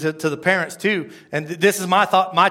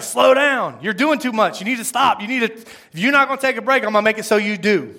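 God laying down the law, loud and high; raised, insistent voice of a fed-up God; God scolding; 6 semitones higher, 5 dB louder than usual. Retold or imitated. God warning the preacher to take a break, scolding loudly. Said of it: "that's how I hear it".

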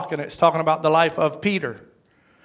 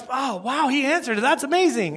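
high, softer wow of pretend surprise; mock amazement; 6 semitones higher, 4 dB quieter than usual. Churchgoer finding a prayer answered, mock amazed. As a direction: imitate someone marveling. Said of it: "like we were surprised it happened".

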